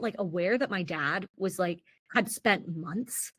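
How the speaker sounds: ordinary delivery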